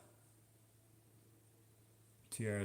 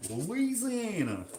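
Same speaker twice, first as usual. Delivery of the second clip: high, drawn-out mock Louisiana accent; hammed-up Louisiana drawl; 9 semitones higher, slower than usual. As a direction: swoop up high in a put-on Louisiana twang. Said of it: "What is the Louisiana accent?".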